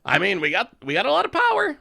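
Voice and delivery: squealed high and loud; gushing over a great hand; excited. Real, not acted. Excited about strong hand.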